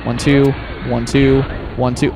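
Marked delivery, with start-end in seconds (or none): none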